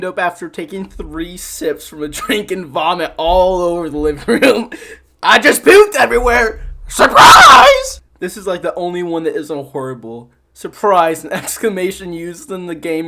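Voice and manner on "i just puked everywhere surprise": high, loud yell rising to a squealed final word; mock excited shout. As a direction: shout it out like a gleeful announcement. Acted announcement after vomiting in example.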